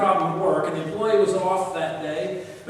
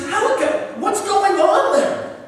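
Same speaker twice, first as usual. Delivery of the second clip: panicked; 11 semitones higher, 6 dB louder, slower than usual. Retold or imitated. Boss panicking on phone.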